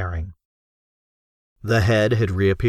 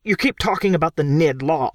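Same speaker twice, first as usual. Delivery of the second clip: complaining; 7 semitones higher than usual. Acted. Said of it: "Crockett complained".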